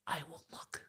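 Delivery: soft unvoiced whisper. Shown as a demonstration. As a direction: breathe it out as a secret.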